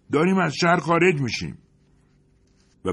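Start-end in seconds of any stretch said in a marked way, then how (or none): 0.1-1.6 high, loud call from the roof hatch; shouting down. Acted. Thin man shouting down to those below, loud call. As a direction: yell it down into the truck. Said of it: "فریاد زد".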